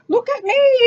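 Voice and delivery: high, loud call from above; excited shout. Acted. Mister Magee shouting down to the townspeople, high shout. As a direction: shout down to everyone below. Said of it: "he shouted down".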